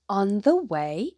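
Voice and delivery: plain reading voice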